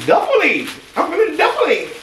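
silly high-pitched swoop; sing-song silliness, pitch leaping up; goofy, giddy exclamation; playfully excited. Real, not acted. Playful excitement over duffel bag.

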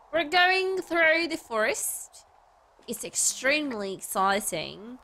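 slow, high, drawn-out presenting voice; mock tour guide. Streamer guiding viewers through the forest, mock presenter. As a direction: stretch it out like a hammed-up nature guide.

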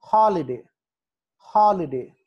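Holiday with a loud first syllable; holiday, loud start, falling end; stress at the H. Shown as a demonstration. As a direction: punch "ho" in holiday. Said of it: "హాలిడేకి H దగ్గర stress".

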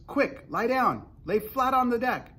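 urgent high-pitched shouted order; crying out. A narrator's reading. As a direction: shout them down to safety. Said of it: "cried James".

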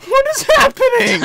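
very high, loud squeak; squeaky hacker voice. Acted. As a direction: shriek it in a nerdy squeak. Hacker character voice.

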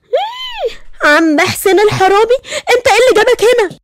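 stunned outburst; shocked. Acted. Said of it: "اتصدمت وقالت".

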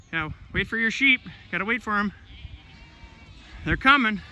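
high, coaxing dog-talk. Real, not acted. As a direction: coax the dog to be patient. Talking to the dog.

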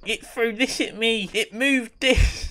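high, slow tattletale whine; mock snitching child voice; tattling ghost hunter. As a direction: whine it like a kid telling on someone. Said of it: "It's like".

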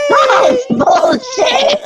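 squeaky high helium voice; talking on helium; chipmunk voice. Real, not acted. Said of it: "What happened to your voice? Sound like a chipmunk now".

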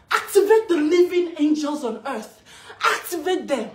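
rally them forcefully, punching each command; rousing battle cry. Real, not acted. Woman urging mothers to fight for their babies, loud battle cry.

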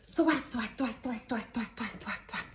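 high, rapid-fire thwacks; rhythmic sound effect. A narrator's reading. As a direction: imitate an animal shaking itself dry. Repeats drying noise for listeners.

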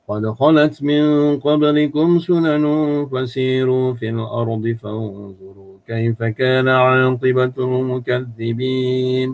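Quran recitation. Shown as a demonstration. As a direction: recite in the Arabic lilt with clear makhraj.

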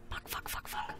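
whisper. Real, not acted. Frustrated whispered swearing.

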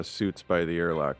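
ordinary talk, nothing marked

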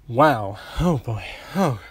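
breathless, gasping exclamations; out of breath. Real, not acted. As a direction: catch your breath between words. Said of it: "Sorry if I sound out of breath".

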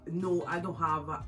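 ordinary delivery

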